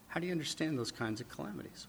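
normal talking voice

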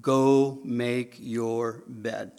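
child mockingly reciting Dad's words; mock slow recital. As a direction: Go make your bed drawn out, very slow. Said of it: "You said".

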